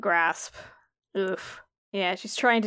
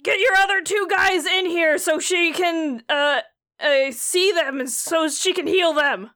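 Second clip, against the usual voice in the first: yelling out; 7 semitones higher, 3 dB louder than usual. Acted. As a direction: call out urgently, higher. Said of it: "Rad actually yells out".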